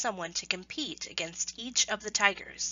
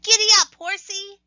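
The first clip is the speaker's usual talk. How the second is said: much higher, loud gleeful yell; 12 semitones higher, 11 dB louder than usual. Acted. Hare to the tiger under him, a high gleeful yell. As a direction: whoop it like riding a horse. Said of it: "he kicked the tiger hard and yelled".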